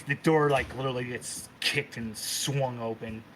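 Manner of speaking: normal talking voice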